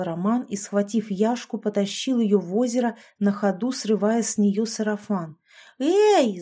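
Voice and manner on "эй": high, loud startled yell. A narrator's reading. Yashka dragged into the lake, high loud yell. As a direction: yelp out in protest. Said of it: "завопила она".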